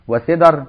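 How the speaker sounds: وسدر as "wa-sidar", a after the d; i then a. Shown as a demonstration. Teacher shows students a variant, وسدر said "wa-sidar". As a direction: say وسدر with kasra on s and fatha on d. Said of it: "بكسر ففتح".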